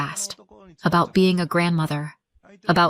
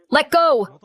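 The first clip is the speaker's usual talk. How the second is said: high, loud shout; 8 semitones higher, 5 dB louder than usual. Acted. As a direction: yell to make her let go. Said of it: "my mom shouted".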